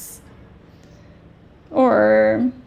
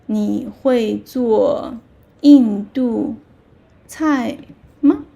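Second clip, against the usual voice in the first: slow, syllable by syllable teacher demo; slow model question; slower than usual. Shown as a demonstration. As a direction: say it slowly for learners to copy. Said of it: "So you say".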